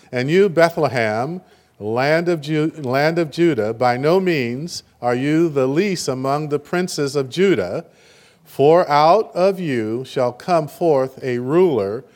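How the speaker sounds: slow, measured Bible reading; solemn scripture read to the congregation. Acted. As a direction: read the verse out slowly and solemnly. Reads the Bible verse aloud.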